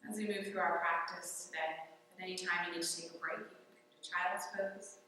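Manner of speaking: unhurried meditative teacher with long pauses; calm yoga guidance. Real, not acted. Yoga teacher opening class on the mat, calm guidance. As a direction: settle the class gently into the breath.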